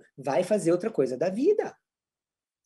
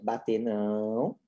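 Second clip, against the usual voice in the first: low, soft "Bater não" with "não" stretched; restrained, lengthened command; drawn-out correction; 9 semitones lower, 7 dB quieter, much slower than usual. Shown as a demonstration. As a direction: slowly hold out the final "não". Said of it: "segura um pouco mais tempo".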